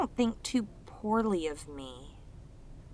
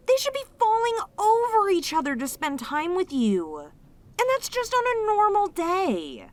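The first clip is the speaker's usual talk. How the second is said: much higher and louder, outraged on their behalf; indignant outburst; 8 semitones higher, 4 dB louder than usual. Acted. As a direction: protest loudly that they deserve better. Defending the lonely listener, indignant.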